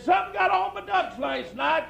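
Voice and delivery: put-on high-pitched old hunter telling a duck joke. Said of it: "had a high voice".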